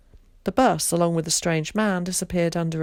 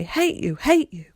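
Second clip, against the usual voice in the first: fading then loud cry; shouted; 9 semitones higher, 4 dB louder than usual. A narrator's reading. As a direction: yell it back down the stairs. Kat shouting while running upstairs.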